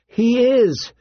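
high, quick insistent protest; insisting. A narrator's reading. Daughter contradicting her father, high insistent protest. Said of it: "Sansa insisted".

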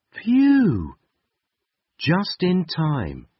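high relieved sigh, then slow. Acted. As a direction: breathe out the relief. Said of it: "he said".

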